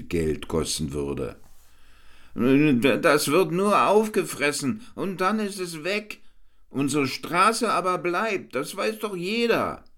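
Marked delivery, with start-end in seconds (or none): 2.3-9.8 high, insistent councillor arguing; a fussy councillor who won't budge; high-voiced councillor. A narrator's reading. Councillor arguing in the council, high and insistent.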